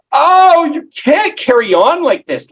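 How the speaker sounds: high, loud mock wail; weepy. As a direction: imitate a weepy grandma carrying on. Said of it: "this weepy".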